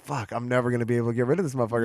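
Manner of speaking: low, quick aside to himself; muttered inner thought. Retold or imitated. Guest recalls realizing he is stuck with his friend, muttered thought. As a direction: mutter it fast under your breath.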